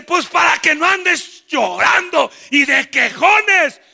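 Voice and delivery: loud slow scolding; stern rebuke. Acted. The Lord answers the whining people. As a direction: scold them sharply.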